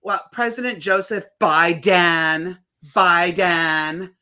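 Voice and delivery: mocking precise-yet-glottal newsy talk; mock glottal stops. Adults talking politics, mock glottal Biden. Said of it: "talking about".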